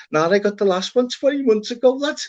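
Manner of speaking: insistent lad. Retold or imitated. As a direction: say it as the lad, fully convinced. Voicing the lad again.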